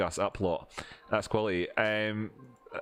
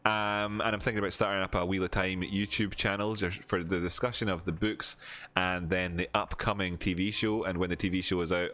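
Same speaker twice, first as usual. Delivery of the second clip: ordinary delivery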